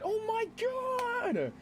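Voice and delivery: high, drawn-out anime dub scream; long, piercingly high mock scream; Joseph Joestar scream. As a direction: imitate an over-the-top Japanese dub yell, stretched right out. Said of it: "he sounded like Joseph Joestar".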